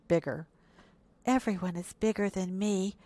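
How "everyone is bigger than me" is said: ordinary delivery, nothing marked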